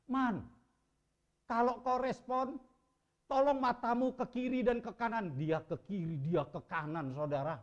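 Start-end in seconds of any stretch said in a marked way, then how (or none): 0.1-5.3 louder, higher urging; earnest plea. Retold or imitated. Brother asking unconscious brother to move his eyes, earnest urging. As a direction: urge him gently but firmly to respond. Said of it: "waktu saya katakan kepada Charman".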